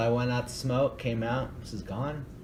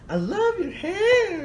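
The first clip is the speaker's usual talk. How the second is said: squeaky high imitation of the older lady gushing; high mock lady voice; 5 dB louder, slower than usual. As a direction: gush it in a much higher, slower put-on voice. Lady's compliment voiced in high pitch.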